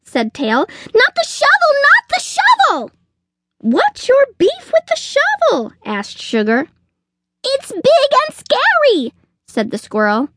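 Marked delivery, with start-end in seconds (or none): 1.0-2.9 extremely high squirrel squeal; panicked shriek. A narrator's reading. Squirrel begging Sugar to leave the shovel, panicked. 3.6-5.7 very high-pitched chicken query; exasperated question. A narrator's reading. Sugar questioning the squirrel's fear, exasperated. 7.4-9.1 very high squirrel squeal; panicked. A narrator's reading. Squirrel explaining fear of the shovel, panicked.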